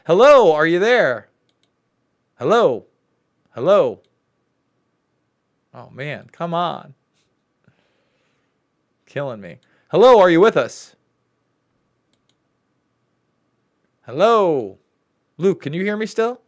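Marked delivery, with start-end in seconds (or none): 0.1-4.0 loud calling hello down the line; shouting into a phone. Real, not acted. Host calling into dead line. 9.9-14.8 loud calling hello down the line; shouting into a phone. Real, not acted. Host calling into dead line.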